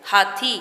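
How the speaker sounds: "हाथी" said together as one word; syllables joined. Shown as a demonstration. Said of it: "इसको हम एक साथ बोलेंगे".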